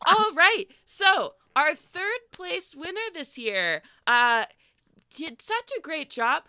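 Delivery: chirpy festival host calling the crowd in; sugary village host, high and beaming; Lila the host. Acted. Lila announces third place.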